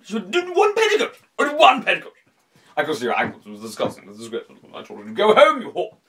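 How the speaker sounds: blustering British mumble bursting into high loud squawks; bumbling British accent. Shown as a demonstration. Host mimicking a scandalised Brit at the shop, bumbling British bluster. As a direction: splutter in an outraged, caricatured British accent. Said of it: "it's too bluffy, it's too bumbling".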